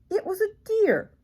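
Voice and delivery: high, bright surprise at the reveal; spring the twist on the children; surprised reveal. A narrator's reading.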